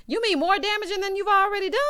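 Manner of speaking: high-pitched disbelieving question; incredulous. Real, not acted. Answering Johnny's damaging-things remark, high and incredulous. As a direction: shoot it back way up high, in disbelief.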